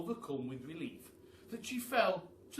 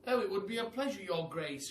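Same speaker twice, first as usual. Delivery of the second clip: higher, loud dismay; glum pelican; 6 semitones higher, 4 dB louder than usual. A narrator's reading. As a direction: sigh it out like a dejected pelican. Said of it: "cried the pelican".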